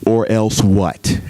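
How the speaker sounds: God's reply. Retold or imitated. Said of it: "I see God saying".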